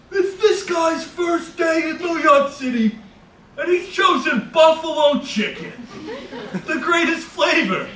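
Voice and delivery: high, loud, slow announcing shout; excited stranger shouting. Retold or imitated. Stranger shouting to the sandwich maker, high and slow. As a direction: proclaim it to the whole shop.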